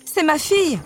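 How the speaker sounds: high, anguished plea; desperate cry. A narrator's reading. The king pleading for his daughter, crying out. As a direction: cry out for a child in danger. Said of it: "cria le roi".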